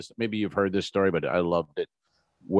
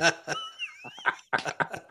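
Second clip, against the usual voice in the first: high-pitched squeal of laughter; 7 dB quieter than usual. Real, not acted. Cracks up at own punchline.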